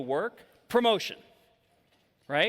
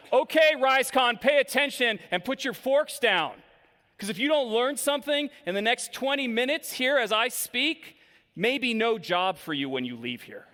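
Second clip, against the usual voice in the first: aggressive speaker; 5 semitones higher than usual. Shown as a demonstration. Speaker confronting the conference audience, aggressive. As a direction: lay down a threat to the room. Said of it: "I'm gonna apologize in advance for this".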